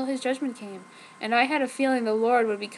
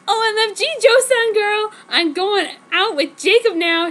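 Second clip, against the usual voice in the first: much higher and louder squealing news; excitedly; 9 semitones higher, 8 dB louder than usual. Acted. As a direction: burst in squealing with gossip. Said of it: "she said all existedly".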